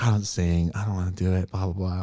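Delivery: low, soft grumbling refusal; reluctant refusal. Retold or imitated. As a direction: imitate an uncle shrugging off a request. Imitates reluctant uncle refusing.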